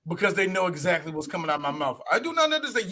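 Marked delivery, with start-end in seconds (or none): 2.1-2.9 higher, feigned-confused no-English line; lightly voiced clerk playing dumb; mock clerk. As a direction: pretend you can't understand.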